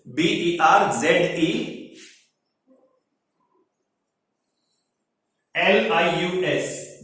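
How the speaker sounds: "बी ई आर जेड ई एल आई यू एस" spelled out slowly, one letter at a time. Shown as a demonstration. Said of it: "ब्रेजिलस". ("बी ई आर जेड ई एल आई यू एस" धीरे-धीरे एक-एक अक्षर बोलकर)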